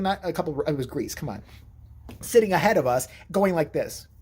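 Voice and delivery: ordinary talk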